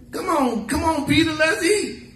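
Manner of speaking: high-pitched, playful call to the table; acted coaxing call. Preacher calls Peter over to eat, high coaxing voice.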